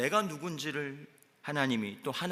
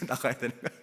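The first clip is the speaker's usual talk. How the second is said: usual speaking voice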